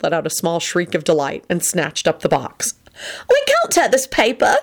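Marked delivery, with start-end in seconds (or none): 3.3-4.6 high, delighted exclamation; excited. A narrator's reading. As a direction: exclaim it, thrilled by the gift. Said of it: "she said excitedly".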